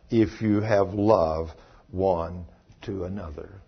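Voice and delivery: slow, low-pitched, weighty recitation; solemn scripture reading. Acted. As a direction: read the verse out word by word. Reads scripture aloud.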